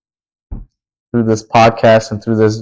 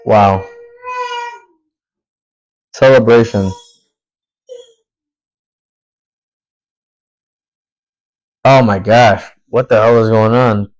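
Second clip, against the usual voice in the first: getting emotional. Real, not acted. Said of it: "why am I getting emotional now?".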